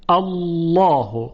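الله very slow, with a thick dark double l, "Allaw-hu"; heavy lam. Shown as a demonstration. Teacher modelling tafkhim twice, slow heavy الله.

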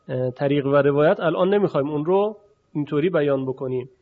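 ordinary delivery

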